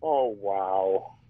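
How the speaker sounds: low-pitched "Oh, wow" like a sampled clip; deep, drawn-out mimicked sample; imitated voice sample. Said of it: "he sampled".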